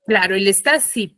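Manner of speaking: normal talking voice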